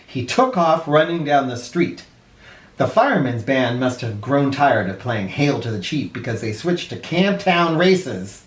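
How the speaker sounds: plain reading voice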